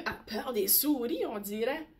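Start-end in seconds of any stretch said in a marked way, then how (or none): none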